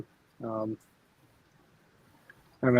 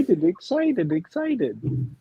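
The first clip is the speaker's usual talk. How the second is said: higher, playful excited chant; mimicked child's excitement; 6 semitones higher, slower than usual. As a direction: echo an excited little kid, word repeated.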